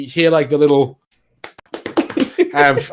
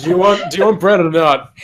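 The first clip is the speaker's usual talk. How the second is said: louder, higher, impatient demand; flustered deflection; 6 semitones higher, 6 dB louder than usual. Acted. Embarrassed baker pressing his customer about bread, flustered. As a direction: push past the embarrassment loudly.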